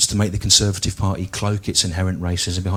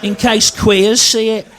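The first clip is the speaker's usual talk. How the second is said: put-on high, strained, ranting old bigot; mock ranting bigot; 12 semitones higher, slower than usual. Comic mocking a bigoted nan, high ranting voice. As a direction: whine it like a paranoid Daily Mail reader.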